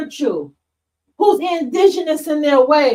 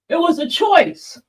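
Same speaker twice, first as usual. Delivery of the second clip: a light mocking echo of the claim. Streamer mimics [name]'s claim to viewers, lightly mocking. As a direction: repeat it back as if in his shoes, scoffing. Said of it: "[name] saying shit like".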